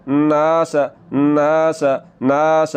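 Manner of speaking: नास with a stretched आ; teacher modelling the long नास; long vowel. Shown as a demonstration. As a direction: draw out the आ in नास. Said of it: "ज़बर को लंबा करना है".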